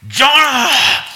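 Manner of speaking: high, booming shout of the name; thunderous call. Shown as a demonstration. Preacher shows the harsh way God did not speak, booming. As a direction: thunder it out like an angry judge. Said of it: "this thunderous voice".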